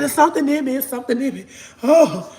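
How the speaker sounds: high-pitched hammed-up wailing; mock panic, breaking into laughter; imitating possessed grandma. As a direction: fake a possession, crying out high. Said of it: "with that like my grandma".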